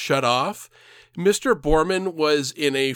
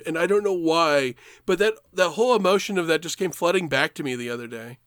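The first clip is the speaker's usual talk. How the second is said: ordinary talk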